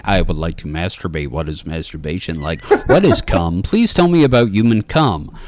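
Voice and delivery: lightly voiced clueless alien asking; a hint of a robotic stranger, curious and flat. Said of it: "he fucking zoned out during that part going".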